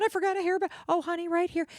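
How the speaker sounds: imitating a kind, fussing desk clerk; sweet fussing host. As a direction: coo over her like a caring friend. Voicing the receptionist.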